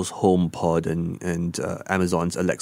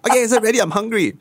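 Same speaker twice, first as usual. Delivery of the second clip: whiny spouse; 4 dB louder, much faster than usual. Retold or imitated. Spouse voiced again, laughing.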